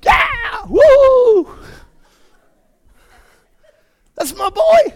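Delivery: very high, loud cheering yell; sideline cheering. Pretend. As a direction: cheer your son on from the sidelines. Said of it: "I'll yell on the sidelines and say".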